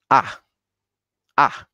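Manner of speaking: short falling "A"; angry tone four. Shown as a demonstration. Teacher repeats the fourth tone for learners, angry falling "A". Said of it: "tono enfadado".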